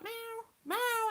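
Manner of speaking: high, drawn-out little meow; high-pitched cat impression; cat's meow. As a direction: imitate a cat meowing for attention. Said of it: "he'd be like".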